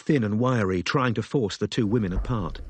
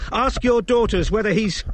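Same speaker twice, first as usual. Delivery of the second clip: shout; 11 semitones higher, 6 dB louder than usual. Acted. Robin shouting mid-fight.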